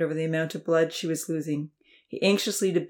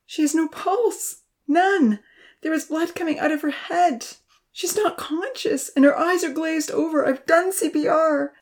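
breathless panic; 10 semitones higher, 3 dB louder than usual. A narrator's reading. Uncle reporting injuries by phone, breathless panic. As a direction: fight for breath between words. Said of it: "struggled to catch his breath".

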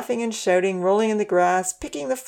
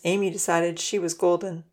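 ordinary delivery

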